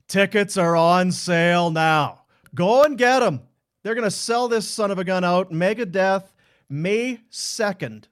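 loud, punched-up ticket announcement; concert promo read. Acted. Host plugging the Megadeth show to listeners, loud promo read.

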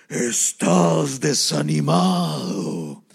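loud, low, very slow demon voice; heavy, drawn-out demonic command; forceful demon. Acted. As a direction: stretch it out low and menacing. Demon's third, stronger try.

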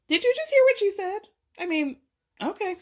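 can't believe what she just heard; high, stunned "did you just hear that"; shocked disbelief. Real, not acted. Shocked reaction to quote.

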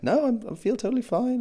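high, strained cover-up voice; mock mild panic. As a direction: imitate someone insisting while starting to panic. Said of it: "getting a bit panicked".

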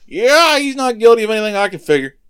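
high, loud, quick acted announcement; offhand Pilate verdict. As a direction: toss the verdict out to a crowd. Pilate addresses the crowd.

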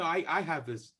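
ordinary talk

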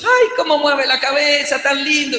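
light mock baby-talk delight; cooing adults. Adults admiring the baby lifting his head, lightly gushing.

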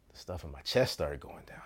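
whispered, low and soft; hushed dread. Real, not acted. Waiting weeks for the casting call, whispered dread. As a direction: breathe it like your chest sinks.

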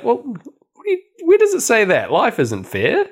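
lightly voiced cranky granddad; exasperated granddad. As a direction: imitate the old man brushing it off. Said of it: "the granddad's like".

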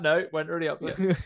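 normal talking voice

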